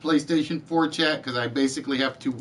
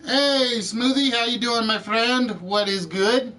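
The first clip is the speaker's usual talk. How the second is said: bright, much higher hello to a friend; cheery greeting; 7 semitones higher than usual. Real, not acted. Host greeting a viewer in chat, cheerful and high. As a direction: sing out a welcome, pitched up high.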